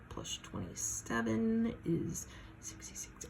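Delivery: quiet whispered sums under breath; muttered adding. Real, not acted. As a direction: mutter the running totals to yourself. Adding in head, first try.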